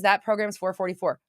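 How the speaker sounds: normal talking voice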